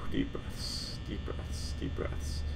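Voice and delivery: unvoiced whisper to yourself; barely-there nervous whisper; whispered self-calming. Real, not acted. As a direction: whisper it to steady your nerves. Calming self during scary game.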